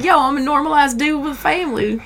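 playful, inexact Southern accent impression; mock Southern accent. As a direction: imitate a caller in a broad-brush Southern accent. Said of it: "put everybody in the same Southern accent".

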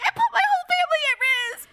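high, rushed, tearful voice; sobbing confession. Retold or imitated. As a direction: blurt it out while starting to cry. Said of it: "And I start crying".

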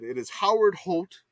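ordinary talk

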